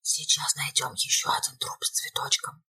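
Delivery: low grim whisper. A narrator's reading. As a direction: breathe it out in the dark. Whispering in the dark house.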